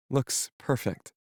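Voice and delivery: put-on soft girlish character voice; young woman voice. Melody voiced higher.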